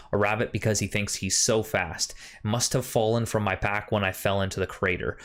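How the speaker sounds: solemn storytelling read; diary narration. Acted. Reads diary entry in game.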